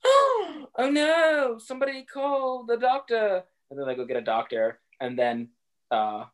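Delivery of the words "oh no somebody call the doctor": high, panicked mock alarm; hammed-up cry for help. Everyone gasps in film retelling.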